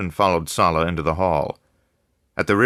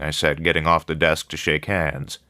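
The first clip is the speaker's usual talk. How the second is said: plain reading voice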